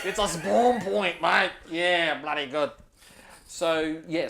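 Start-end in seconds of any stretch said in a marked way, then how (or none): 0.0-2.7 louder, higher ranger laughing at his own joke; pleased with himself, mate; chuckling Aussie ranger. Acted.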